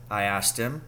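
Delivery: plain reading voice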